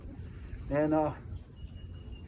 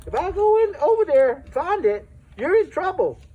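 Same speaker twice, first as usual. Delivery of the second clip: higher and louder mock scolding dad; dad scolding his son, loud raised threat; stern warning; 10 semitones higher, 6 dB louder than usual. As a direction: warn the kid he's in real trouble.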